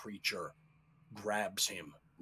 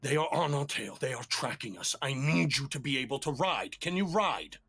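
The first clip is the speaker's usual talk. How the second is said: louder, pressing ranger voice; urgent warning; 4 semitones higher, 10 dB louder, faster than usual. Acted. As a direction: urge them on, danger is close. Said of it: "he grabs you and he goes".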